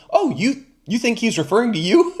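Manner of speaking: high-pitched teasing imitation of the reporter; mocking interviewer. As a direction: toss the question back with a sly lift. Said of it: "And she goes".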